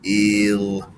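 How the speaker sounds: "Eel" drawn out after the h; sound segment. Shown as a demonstration. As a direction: stretch out "Eel". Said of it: "Let's sound it out".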